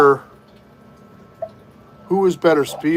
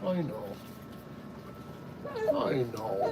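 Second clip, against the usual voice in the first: very soft, low sympathy; barely voiced parental comfort; hushed soothing; 4 semitones lower, 16 dB quieter, slower than usual. Real, not acted. Lulling tired baby to sleep.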